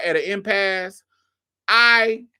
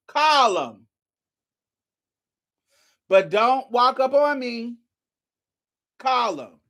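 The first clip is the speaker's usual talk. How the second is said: defiant dare; 8 semitones higher, 4 dB louder, slower than usual. Retold or imitated. Tells chaser off.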